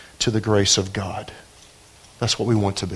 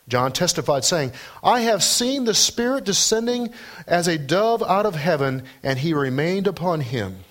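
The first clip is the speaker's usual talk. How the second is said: higher-pitched, declamatory Bible reading; scripture reading; 8 semitones higher than usual. Acted. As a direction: proclaim the passage to the congregation. Reads John's testimony from scripture.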